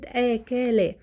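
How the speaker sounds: "اكلا" drawn out syllable by syllable; sounded out slowly. Shown as a demonstration. Teacher introduces an easy example word, "اكلا" sounded out slowly. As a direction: stretch each syllable of "اكلا". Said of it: "انا كده حللت الكلمة دي صوتي".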